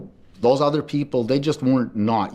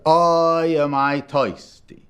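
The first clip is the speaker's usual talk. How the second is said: put-on old man's lament; 6 semitones higher, 3 dB louder, much slower than usual.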